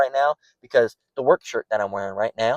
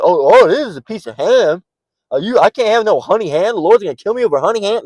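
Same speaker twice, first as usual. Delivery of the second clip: higher, whiny mock voice; mocking a worried believer; 6 semitones higher than usual. Preacher mocking believers who fear eating ham, higher whiny voice. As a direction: ham up a nervous churchgoer fretting over ham. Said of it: "you're gonna think".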